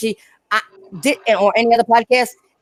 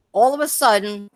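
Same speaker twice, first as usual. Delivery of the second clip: normal talking voice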